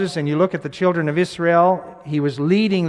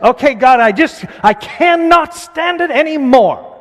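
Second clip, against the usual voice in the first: high, loud, exasperated cry to God; a man at the end of his rope, shouting at God; desperate outcry; 10 semitones higher, 8 dB louder than usual. Acted. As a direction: cry out like you can't take any more. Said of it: "This one in Hebrew is".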